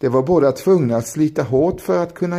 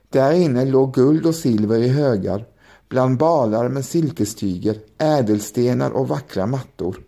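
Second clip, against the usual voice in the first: ordinary delivery, nothing marked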